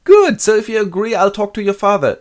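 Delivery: high, loud cheerful suitor; oblivious Collins. Retold or imitated. Collins ignoring her no, high and loud. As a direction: steamroll her refusal with glee. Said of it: "Collins proposes".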